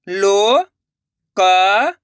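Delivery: Local drawn out very slowly, sound by sound; stretched-out syllables. Shown as a demonstration. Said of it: "अब आगे देखिए". (Local को धीरे खींचकर बोलो)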